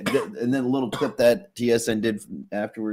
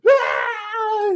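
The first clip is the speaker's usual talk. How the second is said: long, very high scream; hammed-up panicked shriek; losing-it scream. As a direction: scream as if fire surrounds you. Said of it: "me losing my fucking mind".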